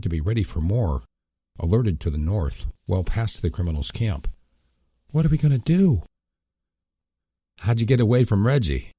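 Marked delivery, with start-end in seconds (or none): 5.1-8.9 higher, worried brother's voice; anxious questions from a hurt man; wounded brother asks his sister, worried and high. A narrator's reading. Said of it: "He seemed quite worried".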